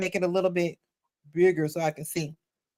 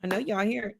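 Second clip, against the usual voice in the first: ordinary talk, nothing marked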